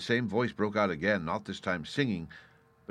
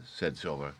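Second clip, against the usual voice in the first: ordinary delivery, nothing marked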